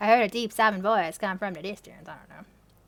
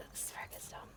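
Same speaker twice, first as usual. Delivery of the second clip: whispered aside; 21 dB quieter than usual. Real, not acted. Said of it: "under-breath aside".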